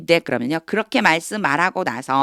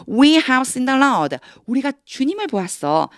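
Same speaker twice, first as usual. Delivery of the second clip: excited disciples; 8 semitones higher than usual. Acted. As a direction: proclaim it with joy. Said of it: "도마에게 말했습니다".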